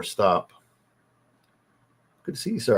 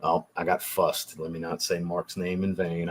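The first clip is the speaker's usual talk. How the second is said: normal talking voice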